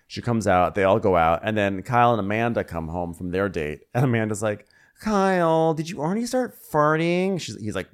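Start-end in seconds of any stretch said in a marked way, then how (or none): 5.0-7.4 high put-on voice of a teasing fiancée; mock teasing wife. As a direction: tease him in a high put-on voice. Voicing Amanda teasing Kyle.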